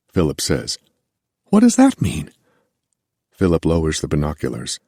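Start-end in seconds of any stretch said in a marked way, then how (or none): 1.5-2.3 alarmed question. Acted. Alarmed question about the body.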